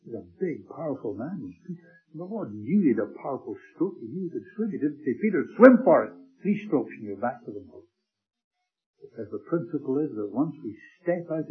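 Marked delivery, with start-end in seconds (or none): 5.1-6.1 mock shout. Imagined command the Lord didn't give.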